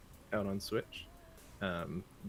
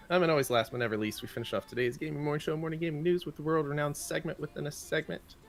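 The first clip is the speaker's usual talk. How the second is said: louder, higher hyped announcer read; segment announcer; 7 semitones higher, 5 dB louder, faster than usual. Acted. Host hyping the show's closing segment, announcer voice. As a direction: hype up the segment like a show host.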